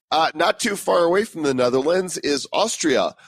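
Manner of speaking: ordinary talk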